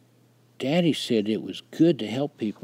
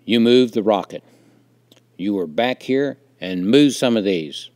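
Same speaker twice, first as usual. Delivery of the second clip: loud, grim accusation; stern father, loud and grim; 11 dB louder than usual. A narrator's reading. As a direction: confront your son grimly and forcefully. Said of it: "he stated grimly".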